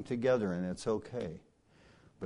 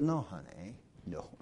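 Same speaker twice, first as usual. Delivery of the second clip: soft reassurance; 6 semitones lower, 10 dB quieter than usual. Retold or imitated. Reassuring the startled little girl.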